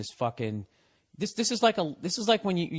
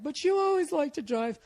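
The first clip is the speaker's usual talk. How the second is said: very high mock girlfriend; put-on girlfriend voice. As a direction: imitate a puzzled girlfriend, pitched way up. Acts out girlfriend questioning him.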